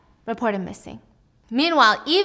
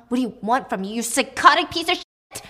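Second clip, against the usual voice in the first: snapping anger; 2 semitones higher, 4 dB louder than usual. Acted. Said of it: "switches off her I'm sorry face".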